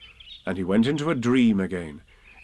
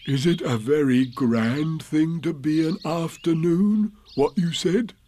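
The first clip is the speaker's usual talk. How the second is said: higher, slow voice for a curious bear; Pooh, wondering bear; 6 semitones higher than usual. Acted. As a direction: ponder it aloud as a simple bear. Story figure Pooh asks a question.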